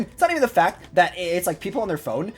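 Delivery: ordinary talk, nothing marked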